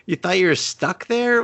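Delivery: high incredulous question; mock disbelief. Viewer mocking the characters, high incredulous disbelief.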